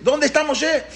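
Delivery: high-pitched, urgent imitated call; retold yelling. Paró asking children where Moshe is, high retold yelling. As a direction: demand it at the top of the voice's range. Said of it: "Y él gritaba".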